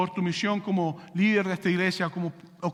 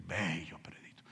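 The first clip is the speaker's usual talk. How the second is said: breathy unvoiced whisper of admiration; 15 dB quieter, slower than usual. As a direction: whisper it in awe. Whispered gossip. (susurro admirado)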